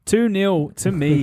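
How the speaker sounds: high, drawn-out crowing; playful gloating. Real, not acted. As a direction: stretch out the score and crow, laughing. Crowing over the score.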